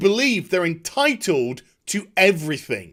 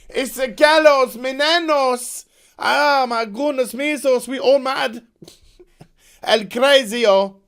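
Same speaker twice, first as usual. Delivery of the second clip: much higher, louder overacted soap-opera voice with mock Spanish; hammed-up telenovela; 7 semitones higher, 5 dB louder than usual. Reviewer parodies the scene as a melodramatic telenovela, much higher and louder. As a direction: ham it up like a bad telenovela star. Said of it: "playing out like a really bad telenovela".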